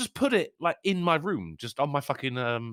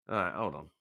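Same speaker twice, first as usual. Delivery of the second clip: ordinary delivery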